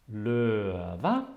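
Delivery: LØVE drawn out, sinking on LØ and jumping up on VE. Shown as a demonstration. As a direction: say løve with a falling first syllable, high second. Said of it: "the first one has this falling".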